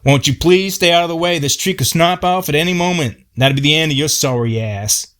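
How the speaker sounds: high exasperated shout; Paul yelling at Robert to stay back. Retold or imitated. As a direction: yell a warning at the pest. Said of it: "started yelling at him".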